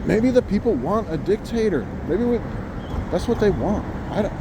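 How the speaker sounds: ordinary delivery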